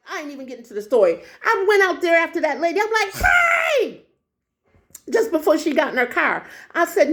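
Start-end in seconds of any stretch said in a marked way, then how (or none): 3.1-4.1 high, drawn-out holler; calling out. Retold or imitated. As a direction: holler after someone getting away. Calling after the fleeing lady.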